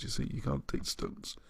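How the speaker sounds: softly whispered repeat; whispered aside. Shown as a demonstration.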